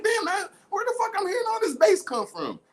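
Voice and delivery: high loud startled shout. Acted. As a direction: yell it out, startled and confused.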